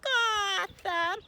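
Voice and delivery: put-on high, slow, whimpering baby Golgotha. Shown as a demonstration. Teacher shows pre-K a crying baby voice. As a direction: cry it out like a tiny baby. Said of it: "Now in a baby voice".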